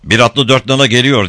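high, loud call; shouted alert. A narrator's reading. Lookout warning the men of a rider, shouting. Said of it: "diye bağırdı".

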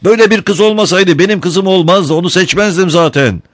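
high, urgent warning; shouting. A narrator's reading. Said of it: "diye bağırdı".

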